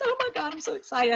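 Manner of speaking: high squealing mock excitement; imitating thrilled friend. Rita squealing with delight at Ann's news. Rita's thrilled reaction retold.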